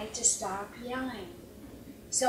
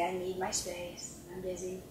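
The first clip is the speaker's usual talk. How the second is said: quiet firm boundary; 4 semitones lower, 9 dB quieter than usual. Retold or imitated. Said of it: "and I'd say".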